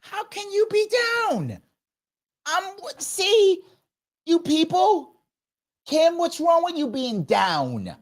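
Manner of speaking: high, mocking disbelief; mock incredulous. Host mocks a guest for being down, high sarcastic squeal. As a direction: squeal out a mocking why are you down.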